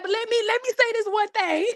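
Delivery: high-pitched mock plea; pleading to speak. Held-back friend begging to speak, mock pleading. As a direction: beg for one last word, high.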